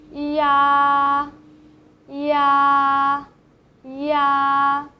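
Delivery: "Ya" as yi plus ah said quickly; joined ya. Shown as a demonstration. Teacher modelling the fast join for learners. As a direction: glide yi quickly into ah. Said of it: "Now, now say it quickly".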